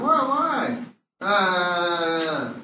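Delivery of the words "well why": high, whining why; whiny plea. Retold or imitated. Comic quoting himself asking neighbors why, high whine. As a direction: whine it like a hurt kid. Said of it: "I'm like".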